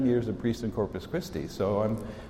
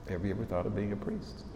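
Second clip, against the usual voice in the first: the same question again, softer and quicker, stress on "ever"; quiet, quicker repeat of the question to the seniors; quieter repeat; 3 semitones lower, 8 dB quieter, faster than usual. Shown as a demonstration. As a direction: let it sink in, softer and faster. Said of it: "repeated question".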